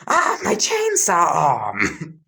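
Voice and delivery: loud, slow, pained yell. Acted. Background kid yells about his chainsaw arm, pained yell. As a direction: cry out over the lost arm. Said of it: "a kid is yelling".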